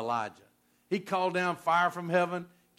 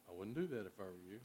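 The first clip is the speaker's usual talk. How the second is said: low, very soft and quick; a quiet inner nudge, murmured quickly; hushed voice of conscience; little voice; 6 semitones lower, 14 dB quieter, much faster than usual. Acted. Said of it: "that little voice".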